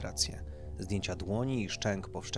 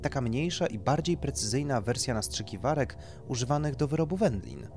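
lightly voiced doctor, raised and emphatic; doctor explaining procedure; 7 semitones higher, 5 dB louder than usual. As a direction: explain it like a consultant to a client.

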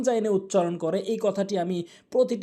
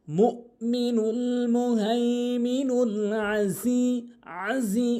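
slow, drawn-out chanted recitation; Quran recitation; 5 semitones higher, much slower than usual. Shown as a demonstration. Teacher reciting the verse slowly for students.

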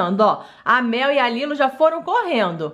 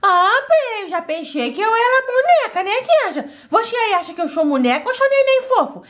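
put-on higher, playful childlike voice; cheeky doll girl asking the audience; little girl voice; 9 semitones higher than usual. Doll girl asks viewers if she's a doll, childlike voice.

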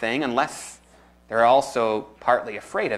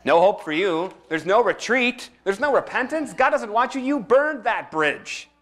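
mocking devil; 6 semitones higher than usual. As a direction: taunt them like a gloating devil. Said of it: "Now he says".

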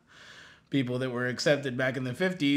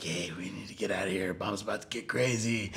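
hushed friends; 6 semitones lower, 7 dB quieter, faster than usual. Retold or imitated. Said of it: "the friends are like".

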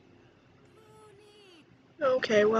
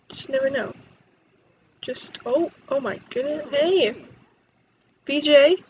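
ordinary talk, nothing marked

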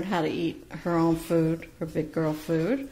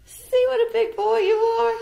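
much higher and louder praise for a bird; high praising pet talk; 5 dB louder than usual. Real, not acted. Owner praising Dill for climbing, high louder praise.

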